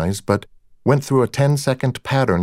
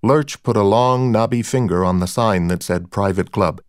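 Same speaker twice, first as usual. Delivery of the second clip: plain reading voice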